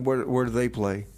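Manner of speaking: ordinary talk, nothing marked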